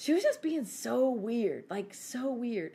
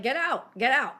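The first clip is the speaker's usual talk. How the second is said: loud, high mock shout; retold angry challenge; 4 semitones higher, 8 dB louder than usual. Woman retelling how she challenged a threatening kid, mock shouting. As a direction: reenact ordering someone out.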